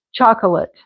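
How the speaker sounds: "Chocolate" as CHOK-uh-lut; three-syllable chocolate. Shown as a demonstration. Teacher joins the syllables, chocolate with a middle uh. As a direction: keep the light uh mid-word in chocolate. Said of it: "You could put another little".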